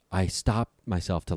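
plain reading voice

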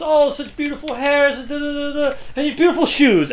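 high-pitched, over-the-top gushing; imitated rosy flattery. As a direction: imitate someone laying compliments on thick. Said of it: "pull out this rosy flattery".

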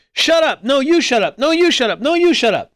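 high-pitched rapid mock squabble; bickering playground children. Children on a playground going back and forth, mock bickering. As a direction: toss the retort back and forth like kids. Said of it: "literally children on a playground going".

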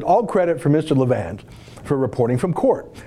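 usual speaking voice

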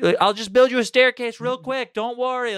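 high, sarcastic quip; mock the demand with fake helpfulness. Sarcastically voicing the victim.

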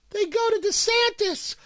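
very high, silly put-on voice; put-on show voice. Host answering his own question about DeSantis, silly high voice. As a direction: pipe up in a squeaky character voice. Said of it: "I don't know whose voice that was".

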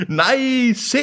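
very high, drawn-out marvel; theatrical high awe; acted amazement. A narrator's reading. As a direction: exclaim in high-pitched wonder. Deacon marvels at the land.

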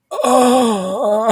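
high mock groan of a weakened hero; hammed-up weak moan; pained groan. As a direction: groan like a sick superhero in the background. Replacement actor groaning as weakened Superman.

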